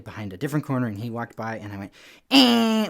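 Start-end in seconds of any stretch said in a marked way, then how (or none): 2.3-2.9 piercingly high, very loud horn blast; imitated air horn. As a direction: blare out an air horn noise. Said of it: "with the air horn".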